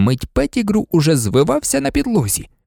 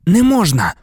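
high, urgent exclamation; breathless plea; 5 semitones higher, 4 dB louder than usual. A narrator's reading. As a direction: blurt it out to stop them. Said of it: "сказав він задихано". (задиханий наполегливий вигук)

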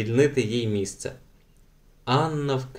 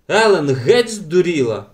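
loud, higher outcry; exclaimed; 5 semitones higher, 5 dB louder than usual. A narrator's reading. Beata reacts to the thrown bottle, exclaiming. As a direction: cry out in shock. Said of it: "вигукнула Беата".

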